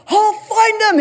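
high, loud mock-heroic yell; hammed-up battle cry; heroic shout. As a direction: charge off after the intruders as you shout. Acts out husband charging after intruders.

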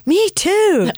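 very high-pitched mock yell; retold excited yell. Wife to husband about a shared calling, excited retold yell. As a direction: yell it high, reliving your own outburst. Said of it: "slamming my hands on the table and yelling".

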